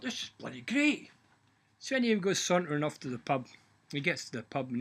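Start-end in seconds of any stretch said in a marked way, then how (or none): 0.0-1.1 higher, delighted exclamation; overjoyed to stand straight. Acted. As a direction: Patrick delighted at straight back.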